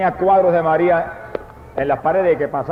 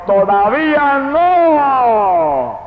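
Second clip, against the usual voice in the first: booming drawn-out divine shout; thundering shout; 8 semitones higher, 4 dB louder, much slower than usual. Retold or imitated. Voice of God shouting at him in the night, booming. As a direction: thunder it out slowly like God's voice. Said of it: "y la voz me gritó".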